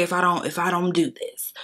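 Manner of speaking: ordinary delivery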